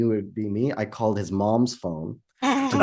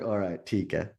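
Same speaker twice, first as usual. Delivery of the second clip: muted and cold, softer, lower; a cold, quiet okay; quiet cold reply; 4 semitones lower, 8 dB quieter than usual. Retold or imitated. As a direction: swallow the anger and say it quietly. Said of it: "So I was like".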